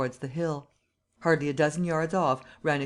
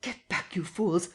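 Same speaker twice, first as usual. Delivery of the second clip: crying out; 3 semitones higher than usual. A narrator's reading. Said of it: "cried the muffled voice of Kemp".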